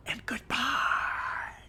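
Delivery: a villain's slow whispered goodbye. Retold or imitated. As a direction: breathe the farewell like a threat. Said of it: "then he screams".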